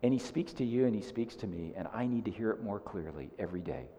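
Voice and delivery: ordinary talk, nothing marked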